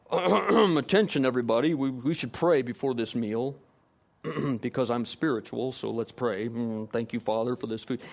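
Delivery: a hint of the self-important man praying to be seen; mock showy Pharisee. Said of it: "you become a Pharisee ... being seen by others".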